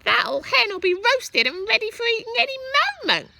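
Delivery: high, loud cackling granny voice; shouting Grandma. A narrator's reading. Grandma shouting down about the roasted hen. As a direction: shout it gleefully like a nasty old granny. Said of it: "Grandma shouted".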